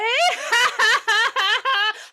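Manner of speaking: high, loud staccato cackle; hammed-up villain laugh; evil child cackle. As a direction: cackle gleefully at your victim. Child revealing himself with a cackle.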